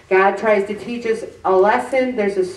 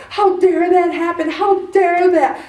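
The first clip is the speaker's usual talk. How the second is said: high, louder, indignant mock yell; raised-voice imitation of an angry outburst; retold angry yelling; 10 semitones higher, 5 dB louder than usual. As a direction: re-enact yelling in outrage at nobody. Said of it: "screaming and yelling at nobody".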